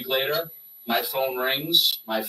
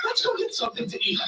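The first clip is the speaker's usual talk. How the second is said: much higher and quick, a hushed put-on promoter; high fast Paul voice; 3 dB quieter, faster than usual. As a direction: rattle it off in a high sneaky voice.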